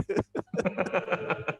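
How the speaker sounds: amused chuckling laugh; light, drawn-out chuckle. Real, not acted. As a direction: chuckle at your own joke. Joking about putting people to sleep.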